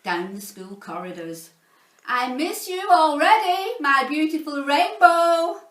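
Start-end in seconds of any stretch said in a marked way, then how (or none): 2.1-5.6 high, loud swooning call down the corridor; lovestruck calling out. Acted. Mister Lovey calling to Miss Dovey down the corridor, loud and high. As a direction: shout the endearment across the school. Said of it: "calling out messages of love".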